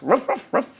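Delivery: light ruff ruff woofs; imitated dog bark. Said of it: "dog barks".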